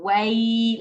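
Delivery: "way" slowly drawn out on its glide; lean sound. Shown as a demonstration. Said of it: "it's normally a lean sound".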